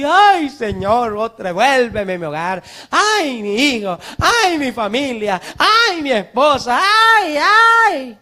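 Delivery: hammed-up sinner crying for his family, rising high; mock wailing. Pastor mocking the late repentant sinner, hammed-up wailing. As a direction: wail like a man begging too late. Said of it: "Ay, sí".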